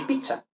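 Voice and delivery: pizza as picha, with a ch; Spanish ch. Shown as a demonstration. Teacher contrasts two sounds, pizza as picha. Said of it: "vemos que no es lo mismo".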